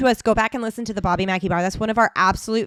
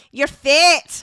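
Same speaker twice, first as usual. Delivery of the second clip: put-on loud, higher Scottish accent; 5 semitones higher, 6 dB louder than usual. Podcaster quoting an animated film, loud Scottish accent. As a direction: exclaim it like a Scot. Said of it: "you gotta do it for the people now".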